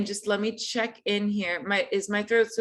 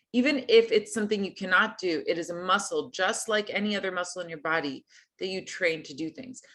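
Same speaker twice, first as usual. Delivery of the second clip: ordinary talk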